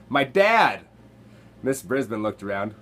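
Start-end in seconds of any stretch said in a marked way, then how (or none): 0.1-0.8 put-on loud, lively boy's call-out; shout. A narrator's reading. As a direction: shout it out to the class. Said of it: "a voice shouted".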